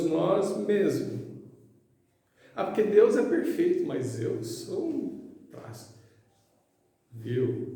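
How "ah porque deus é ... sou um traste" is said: high, hurried whine that slows and sinks; mock self-deprecating believer. Mocking someone who feels worthless before God, high-pitched self-deprecation. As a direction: mimic a believer putting themselves down.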